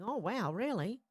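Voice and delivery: usual speaking voice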